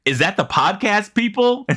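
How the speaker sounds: high-pitched imitation of an eager onlooker; mock surprised recognition; imitating Wooly. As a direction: ask it high, as if spotting someone known. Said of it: "he's like".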